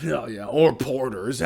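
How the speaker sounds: slow joking pirate voice; slow pirate drawl. Acted. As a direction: drag it out lazily.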